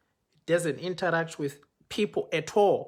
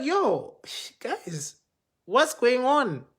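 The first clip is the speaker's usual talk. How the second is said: startled challenge; 7 semitones higher, slower than usual. Retold or imitated. Speaker confronting the protocol guys, high startled challenge. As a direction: call out, caught off guard. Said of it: "I'm like".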